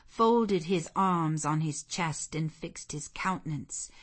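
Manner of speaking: usual speaking voice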